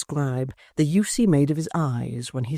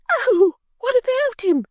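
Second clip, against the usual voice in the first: very high, slow, tearful fake fright; a martyr bidding farewell, squeaking; Miggs, faint and fearful; 5 dB louder than usual. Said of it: "cried Miggs".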